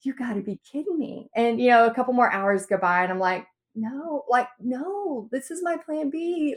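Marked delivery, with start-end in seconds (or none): 0.0-1.3 soft, muttered disbelief; under your breath, deflated. Retold or imitated. Nobody buying surfboards. 3.7-6.5 soft, high, pleading despair. Retold or imitated. Despair as no sales come.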